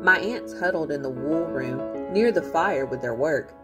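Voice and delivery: ordinary delivery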